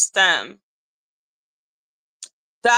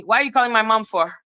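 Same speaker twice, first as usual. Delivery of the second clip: fast scolding; much faster than usual. Retold or imitated. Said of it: "And I'm like".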